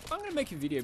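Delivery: high, eager burst; bursting with excitement; excited. Retold or imitated. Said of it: "I got real excited".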